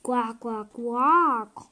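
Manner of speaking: lightly voiced frog croaks, last one loud; funny frog voice. Child voicing the frog at the well, mild funny croak. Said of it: "kommt eine komische Stimme".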